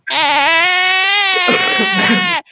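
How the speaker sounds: long, loud, high held "eh" noise; drawn-out bleat. Retold or imitated. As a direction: imitate a man bleating loudly into an armpit. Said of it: "just started going".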